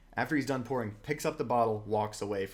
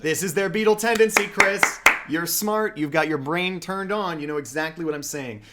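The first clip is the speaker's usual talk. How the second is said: much higher and louder, gleeful praise; excited shout; 6 semitones higher, 10 dB louder than usual. Real, not acted. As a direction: shout it back with glee. Excited agreement shouted back.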